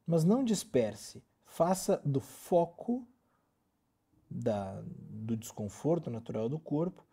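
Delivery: usual speaking voice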